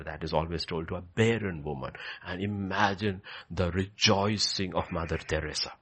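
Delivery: ordinary talk, nothing marked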